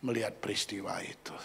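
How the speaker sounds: soft, choked, near whisper; holding back tears. Real, not acted. Pastor fights tears recalling the gift, nearly whispering. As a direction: hold back the tears as you speak. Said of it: "pinginnya nangis, saya tahan-tahanin".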